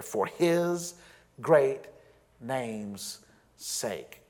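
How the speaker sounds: HIS stressed, then pauses before GREAT and NAME'S SAKE; deliberate pauses after "his" and "great"; added emphasis. Shown as a demonstration. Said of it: "And I added the emphasis there".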